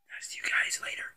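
breathy whisper to the viewers; whispered goodbye. Real, not acted. Vlogger whispering goodbye to his viewers, a hushed sign-off.

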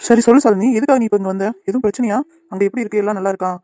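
ordinary talk, nothing marked

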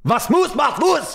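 high, exaggerated German phrase; mock German. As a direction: ham up a German accent, pitched high. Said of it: "I'm getting good at it".

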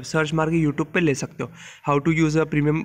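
ordinary delivery

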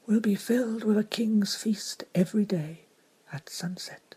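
breathy, secretive whisper; hushed whisper. Acted. Ramandu whispering the table's promise to the crew.

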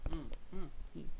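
ordinary delivery

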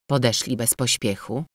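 ordinary delivery

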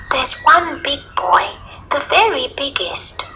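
put-on high little girl voice. A narrator's reading. Little girl telling the lion about a bully, high child voice.

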